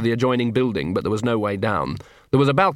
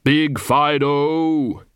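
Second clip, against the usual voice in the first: slow calling out; 3 semitones higher, much slower than usual. Acted. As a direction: call out slowly over the edge. Calling down after fallen dog.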